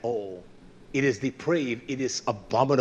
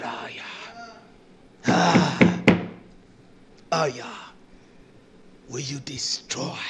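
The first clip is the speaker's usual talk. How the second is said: anguished cry, hushed. Acted. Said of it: "He cried".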